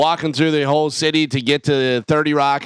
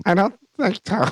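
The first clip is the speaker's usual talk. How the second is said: squeaky helium voice; 7 semitones higher than usual. Retold or imitated. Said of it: "She goes".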